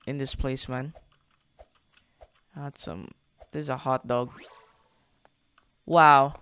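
ordinary talk, nothing marked

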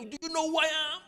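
high indignant who-do-you-think-I-am yell; hammed-up road-rage bluster; mock arrogant shout. Mimicking people fighting on the road, mock arrogant shout. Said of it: "When you see people fighting, you say".

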